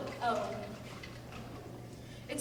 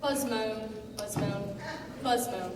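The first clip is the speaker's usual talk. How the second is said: low droning wounded bumblebee moan; bee voice; 5 semitones lower than usual. Acted. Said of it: "sounding like a wounded bumblebee".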